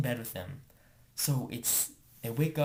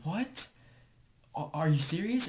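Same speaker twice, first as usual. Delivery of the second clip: higher-pitched, incredulous retold question; mock disbelief; 5 semitones higher than usual. Girl reacting to being sent to hide, in mock disbelief. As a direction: act out a startled "you can't mean it". Retelling girl's disbelief at bathroom order.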